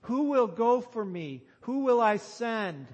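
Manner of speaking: ringing, high appeal; God. Acted. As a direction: call it out as a summons. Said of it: "as God asks".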